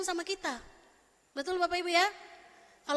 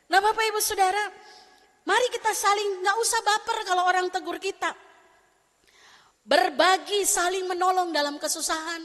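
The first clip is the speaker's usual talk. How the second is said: louder, rousing sermon call; preaching; 3 semitones higher, 6 dB louder than usual. Acted. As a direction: exhort the congregation with force. Preaching exhortation. (khotbah berapi-api)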